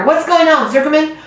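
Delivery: alarmed announcer. Acted. Announcer alarmed at fainting pig.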